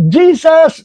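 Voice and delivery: high ringing cry of Jesus; shouted name. Retold or imitated. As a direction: call out the name high and strong. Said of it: "Sumigaw ako ng malakas".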